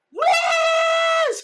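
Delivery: very high, loud furious yell of the name; furious shout. Retold or imitated. Client shouting the reader's name in anger, a piercing yell. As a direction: shriek the name in outrage. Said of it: "she was so mad".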